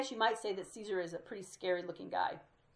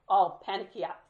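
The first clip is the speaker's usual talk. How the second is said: normal talking voice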